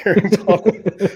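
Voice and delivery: words spoken through a laugh; chuckling mid-sentence. Real, not acted. Joking about his own six pack.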